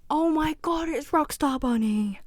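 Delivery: high, loud gush of delight; excited reveal. Real, not acted. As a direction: fangirl at a favourite character. Excited reveal of favourite character.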